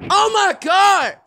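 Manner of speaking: high, drawn-out yell; exasperated outcry. Real, not acted. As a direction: cry out at the unfair play. Reacting to game play.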